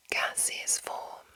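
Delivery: ordinary talk, nothing marked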